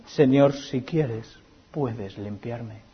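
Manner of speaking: very slow, soft pleading; hushed plea. Acted. Preacher repeats the leper's plea to Jesus, hushed and slow. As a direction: let each word fall softly.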